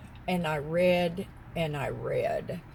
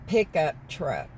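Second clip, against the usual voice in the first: normal talking voice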